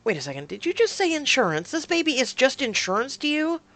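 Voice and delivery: high, loud, rising questions; indignant disbelief. Acted. Woman confronts partner over "insurance", indignant disbelief. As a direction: pounce on the word, offended. Catches the word insurance, quarrel resumes.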